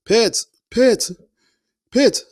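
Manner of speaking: high loud calling of a name; urgent shouts to rouse him; someone yelling his name. Retold or imitated. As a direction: call out to a wounded man. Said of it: "I just hear somebody yelling".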